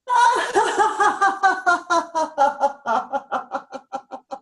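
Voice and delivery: high rising laughter; released laugh. Shown as a demonstration. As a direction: let a worry go with a laugh. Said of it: "release another one".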